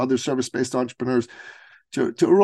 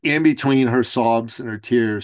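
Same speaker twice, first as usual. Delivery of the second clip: ordinary delivery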